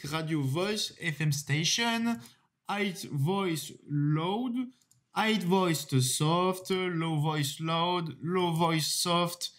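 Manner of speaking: slow English list read with a real French accent. Real, not acted. Presenter reading preset names to viewers, French-accented English. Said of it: "désolé pour mon accent anglais qui est vraiment horrible".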